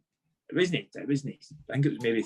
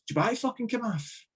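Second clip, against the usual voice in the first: high mock gaffer voice, half laughing; teasing Walter Smith; 9 semitones higher than usual. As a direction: tease the player like the boss did. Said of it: "he's like".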